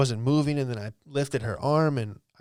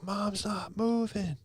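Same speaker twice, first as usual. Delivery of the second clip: scared plea; 8 semitones higher, 5 dB quieter, slower than usual. Retold or imitated. Calling down about unresponsive mom.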